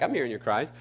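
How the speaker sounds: lightly voiced unruffled God; God's calm reply. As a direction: answer calmly, dropping low and soft. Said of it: "He's like".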